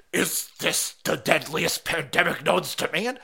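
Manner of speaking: slow, drawn-out mock alarm; mock dread. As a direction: ask it slowly like an overblown doomsayer. Mocking covid alarm.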